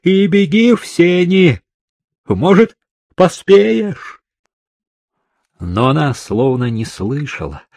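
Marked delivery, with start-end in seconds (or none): 0.0-4.2 high, loud urgent shout; urgent yell. A narrator's reading. Kryzhov urging the trapped woman to run, yelling. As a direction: yell instructions at top of the voice. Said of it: "заорал Крыжов".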